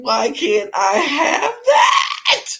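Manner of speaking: real laughing sob, shouted slowly; laugh-crying shout. Real, not acted. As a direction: lose it completely while asking. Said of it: "I lost it".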